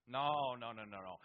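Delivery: ordinary delivery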